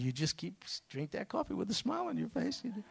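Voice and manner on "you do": normal talking voice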